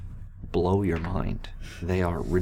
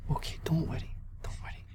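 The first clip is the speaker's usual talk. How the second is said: whispered higher reassurance; calm the frightened child; soothing whisper; 7 semitones higher, 6 dB quieter, faster than usual. Acted. Paladin soothing the girl, whispered.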